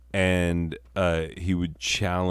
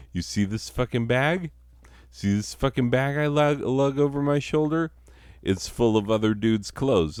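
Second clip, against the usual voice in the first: pitched up, boasting about the bag; high mock bragging stranger; 7 semitones higher than usual. Stranger brags to the townsfolk in a high, boastful voice.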